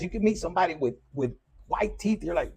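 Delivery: ordinary talk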